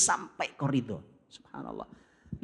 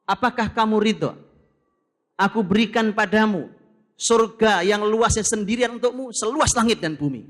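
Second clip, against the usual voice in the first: majestic call; 5 semitones higher, 8 dB louder than usual. Acted. Voicing Allah's grand offer.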